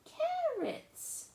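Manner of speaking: very high, loud, slow indignant question; fussy little sister. Acted. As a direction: demand an answer like an outraged little girl. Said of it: "Lola's line".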